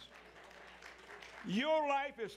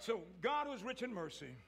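ordinary talk, nothing marked

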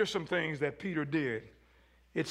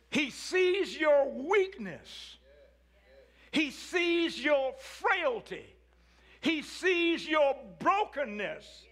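slow preaching refrain; 7 semitones higher, much slower than usual. Acted. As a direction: press each "He sees" slowly home. Builds a slow emphatic refrain.

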